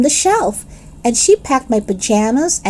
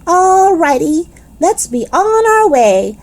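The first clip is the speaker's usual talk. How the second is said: excited shout; 7 semitones higher than usual. Acted. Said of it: "I shouted, very excited".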